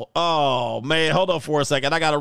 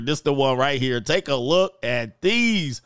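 normal talking voice

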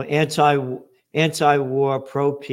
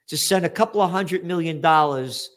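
ordinary talk

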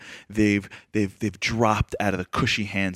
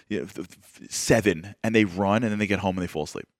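ordinary talk, nothing marked

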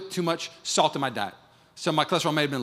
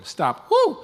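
ordinary talk

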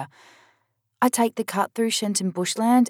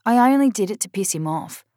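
ordinary delivery, nothing marked

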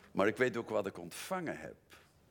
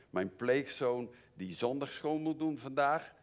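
usual speaking voice

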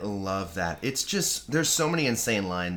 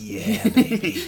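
put-on slow, drawn-out, higher "Yeah, baby"; drawn-out gloating "Yeah, baby"; smug Hannibal; 9 semitones higher, 3 dB louder, much slower than usual. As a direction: purr it like a smug villain. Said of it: "Hannibal's smiling".

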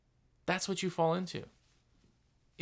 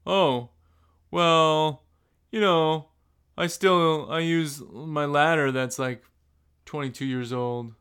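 a louder, halting mock excuse-maker; mock unmotivated guy; 4 dB louder, slower than usual. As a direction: hem and haw like someone stuck in the past.

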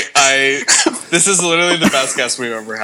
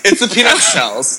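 usual speaking voice

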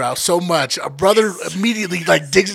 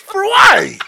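incredulous shout; 10 semitones higher, 6 dB louder than usual. Real, not acted. Friends mock a pointless lie, shouting in disbelief. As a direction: shout it high and loud in disbelief.

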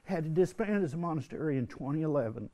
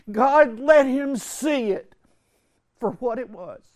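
much higher and louder, slow, ringing out; impassioned climax; 10 semitones higher, 10 dB louder, slower than usual. Real, not acted. Retreat speaker crying out the story's climax, impassioned. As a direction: let it ring as the peak of the sermon.

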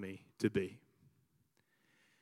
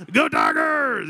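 high, loud fan shout; the Cajun kid shouting for LSU; mock shout; 13 semitones higher, 9 dB louder, slower than usual. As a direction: belt it out for the team. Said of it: "Cajun kid in our school".

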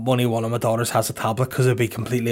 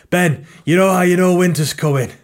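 loud, higher put-on fantasy character; Game of Thrones voice; 6 semitones higher, 5 dB louder than usual. Comedian asks Ben about winter coming, in loud mock Game of Thrones voice.

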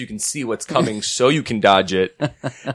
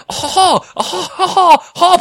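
very high, loud exclamations; hammed-up Japanese caricature cries; mock Japanese caricature; 6 dB louder, much slower than usual. Family miming the word Japanese for her, mock caricature. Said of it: "all her family is like".

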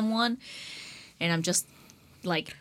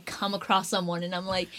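ordinary talk, nothing marked

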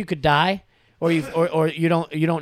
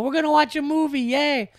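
put-on high-pitched, gleeful kid voice; excited kid; 11 semitones higher, 3 dB louder than usual. Son thrilled about movie night with dad, high excited voice. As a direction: squeal it like a happy child.

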